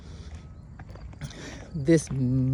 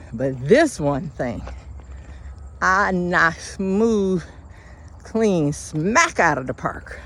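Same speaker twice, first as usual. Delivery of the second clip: preaching; 3 semitones higher, 4 dB louder than usual. Acted. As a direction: build it up like a sermon. Preaching climax.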